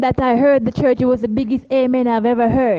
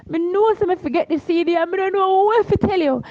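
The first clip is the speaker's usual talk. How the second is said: higher Jamaican patois, voice pulled up; Jamaican patois man; 6 semitones higher than usual. Retold or imitated. Stranger admits forgetting the CD, higher Jamaican patois. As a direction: play the stranger in patois, near tears. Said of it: "and he's like".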